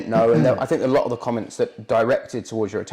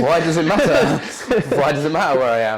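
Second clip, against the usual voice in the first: laughing; 3 semitones higher, 4 dB louder than usual. Real, not acted. Laughing at creepy question.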